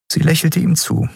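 ordinary delivery

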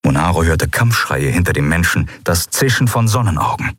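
ordinary delivery, nothing marked

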